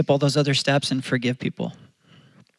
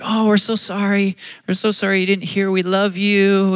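higher-pitched cooing reassurance; soothing parent; 6 semitones higher, 3 dB louder than usual. Retold or imitated. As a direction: comfort an upset little boy. Parents soothing a crying child.

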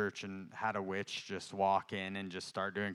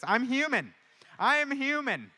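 high, loud self-defence; comic protest; 9 dB louder than usual. Real, not acted. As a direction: plead it loudly, pitch swooping up.

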